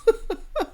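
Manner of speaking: sudden high squeal of a laugh. Real, not acted. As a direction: burst into a shrill cackle. Laughing at her own point.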